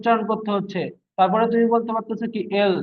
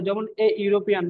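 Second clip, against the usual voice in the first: ordinary talk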